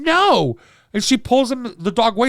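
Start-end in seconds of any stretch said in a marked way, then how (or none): 0.0-0.6 high, loud mock yelp of No; mock shriek. Owner yanks the dog back, mock high yelp. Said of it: "and she's going".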